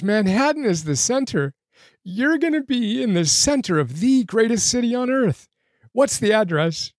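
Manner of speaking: high, choked-up excitement; moved nearly to tears while reading; getting emotional. Real, not acted. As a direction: push on through rising emotion. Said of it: "I'm getting emotional".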